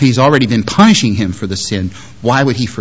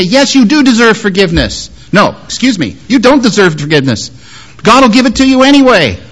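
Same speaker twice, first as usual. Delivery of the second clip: emphatic reassurance; 8 semitones higher, 4 dB louder than usual. Acted. Reassuring a repentant sinner.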